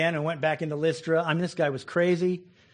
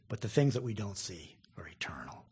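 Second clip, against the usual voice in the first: whispered; 8 semitones lower, 9 dB quieter than usual. Acted. Whispered climax of verse.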